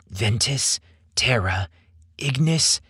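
usual speaking voice

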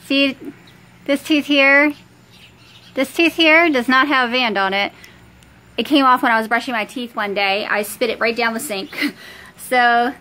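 lip held down by finger; talking with lip pulled; finger pulling lip. Real, not acted. Seen 0: finger pulls lower lip down exposing braces throughout.

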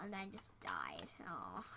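whispered lament. Real, not acted. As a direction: whisper in defeat. Whispered after dying.

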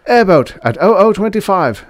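high, loud spotter call; loud announcement. Acted. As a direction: call it out across the bridge. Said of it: "a spotter announced".

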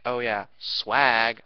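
slow, loud drawn-out mock cool guy; mocking swag bro. Mocking people who brag about swag, slow and loud. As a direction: stretch it out like a poser bragging. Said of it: "there's a lot of people that are like".

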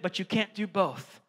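ordinary delivery

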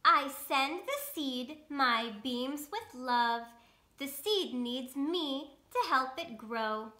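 lightly voiced slow storybook sun; sun character. Reading the sun's lines to children, lightly voiced. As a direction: read it slow and kind as the sun.

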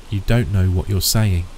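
ordinary delivery

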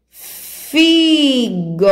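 sílabas juntadas devagar. Shown as a demonstration. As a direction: blend FI and GO slowly. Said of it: "juntar essas duas sílabas e formar a palavra". (palavra esticada, em tom mais alto)

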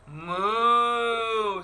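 a playful imitated moo; storybook cow mooing. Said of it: "said Cow".

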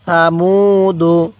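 সামুদু drawn out slowly syllable by syllable; slow recitation demo. Shown as a demonstration. Teacher slowly models সামুদু for students. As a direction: read সামুদু slowly and evenly. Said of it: "হ্যাঁ, আরেকবার".

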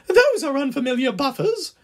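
very high, put-on squeaky coach voice; crying coach. A narrator's reading. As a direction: cry out in high-pitched protest. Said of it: "cried one".

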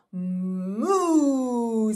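cow moo. Acted. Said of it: "says the cow".

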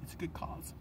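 whispered mock reporter line. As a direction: breathe it like a secret aside. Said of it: "he's just all".

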